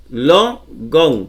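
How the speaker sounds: "Logon" split in two, stress on "Lo"; penultimate stress. Shown as a demonstration. Teacher drilling the accent, "Logon" split with stressed LO. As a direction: lean on the LO of "Logon", then "gon" apart. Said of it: "penúltima".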